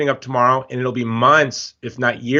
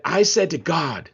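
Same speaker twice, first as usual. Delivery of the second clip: slow, hammy attempt at Aussie; Australian accent, drawn out; bad Australian accent; 4 dB quieter, slower than usual. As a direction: put on a clumsy Australian accent. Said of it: "this is my horrible Australian accent".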